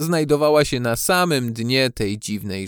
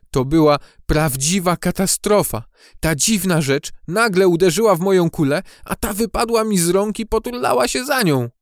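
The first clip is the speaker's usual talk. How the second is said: higher, agitated character voice; distressed beetle; 6 semitones higher than usual. A narrator's reading. Benio tells his friends of the disaster, agitated. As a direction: recount the disaster with breath-catching drama. Shaken beetle recounts the catastrophe.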